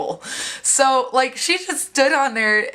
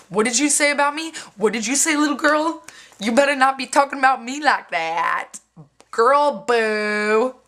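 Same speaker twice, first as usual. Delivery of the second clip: imitated sassy girl, pitched up; higher-pitched mock confrontation, dragging out the final dismissal; confrontational challenge; 4 semitones higher than usual. Girl who overheard confronts her, mock challenging.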